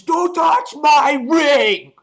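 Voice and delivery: slow, very high, loud mock shout; hammed-up roar of rage; villain shout. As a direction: bellow it like a possessive villain. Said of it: "and he says".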